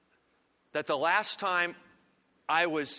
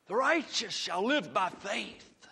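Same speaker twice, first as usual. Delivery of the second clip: slow, weighty reading of the verse; the theme verse, declared slow and grave; solemn proclamation; 4 semitones higher, slower than usual. Shown as a demonstration. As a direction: lean on each word, slow and solemn. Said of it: "proclaims the theme verse".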